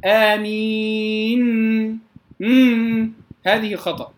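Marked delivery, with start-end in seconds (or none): 0.0-3.1 very slow آمين trailing into a long hummed noon; over-held ghunna. Shown as a demonstration. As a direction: keep humming the final noon of آمين. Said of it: "لا أزيد في الغنة". (إطالة غنة النون في آمين)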